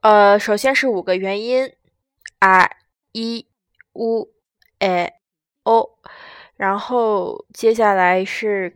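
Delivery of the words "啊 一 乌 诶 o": a, i, u, e, o read out slowly one by one; 日语元音示范朗读; Japanese vowels read out. Shown as a demonstration. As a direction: read the five vowels clearly for learners. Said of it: "我就给大家先读一下这五十个音图吧".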